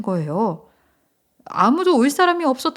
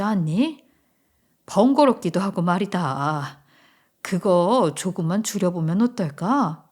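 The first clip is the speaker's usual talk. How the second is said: put-on lower, measured older relative; mother-in-law making a request; 4 semitones lower than usual. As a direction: gently reason with your daughter-in-law. Mother-in-law asks to cut back. (낮은 목소리로 타이르는 시어머니)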